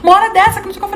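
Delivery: high, hushed imitation of a flustered mother. Mother surprised by daughter's arrival, flustered imitation. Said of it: "toda esbaforida".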